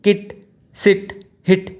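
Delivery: quick short i in "किट, सिट, हिट", spaced apart; short vowel. Shown as a demonstration. Teacher contrasting with long vowels, short "किट, सिट, हिट". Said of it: "they are not extended sounds".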